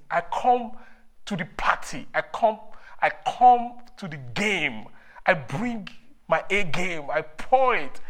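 loud fervent declaration; preaching at full force. Acted. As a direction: declare it with fire. Preacher rising in fervour.

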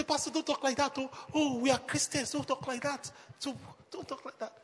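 scandalised churchgoer. Retold or imitated. Congregation member begging the pastor to stop, mock scandalised.

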